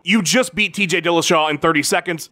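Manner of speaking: ordinary talk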